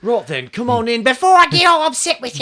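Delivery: high mock-English accent sliding into leprechaun; bad English accent. Co-host mimicking actor's bad accent, high leprechaun-like English. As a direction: do terrible English, nearly leprechaun Irish. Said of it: "It sounds like a leprechaun".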